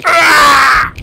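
loud high scream. Pretend. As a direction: scream as you're dragged under. Whale attack again.

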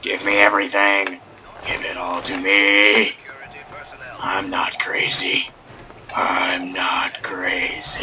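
slow, low whispered insistence; slow, low, creepy whisper to himself. Pretend. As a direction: whisper it slowly like someone unhinged. Creepy muttered repetition.

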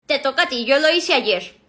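ordinary delivery

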